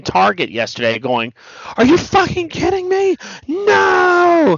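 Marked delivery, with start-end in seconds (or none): 1.8-4.6 high-pitched mock scream of outrage. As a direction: imitate a fan screaming in disbelief. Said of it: "I saw a guy screaming in Tar".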